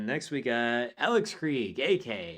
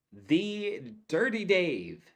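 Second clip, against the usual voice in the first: "the Dirty Dave" drawn out slowly and higher; slow hyped announcement; 5 semitones higher, slower than usual. Acted. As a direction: stretch out "the Dirty Dave" like a big reveal. Host announces caller name slowly.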